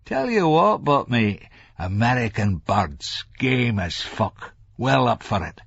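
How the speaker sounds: a hint of leering confidence; conspiratorial Larry. A narrator's reading. Larry confides about American women, conspiratorial. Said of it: "let his face crease in conspiracy".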